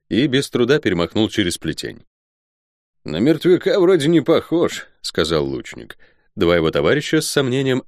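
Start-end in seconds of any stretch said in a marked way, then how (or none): 3.0-4.9 lightly voiced archer, pitched up and sizing someone up; higher, sceptical soldier; a hint of a doubting archer; archer, doubtful. Archer's line in story.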